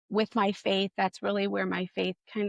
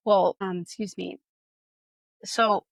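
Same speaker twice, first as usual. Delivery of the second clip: ordinary delivery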